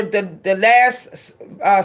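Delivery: usual speaking voice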